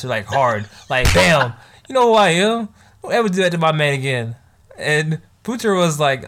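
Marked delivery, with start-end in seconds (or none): 1.1-4.4 a sharp, loud "Bam" and a higher challenge; loud mock slap. Girl defends her man after a slap, with loud challenge. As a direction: slap her and stake your claim out loud.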